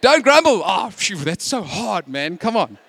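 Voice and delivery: hammed-up grumble at being told off; mock grumbling. Preacher mimics people grumbling about the command not to grumble, exasperated. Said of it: "we could even grumble about the command".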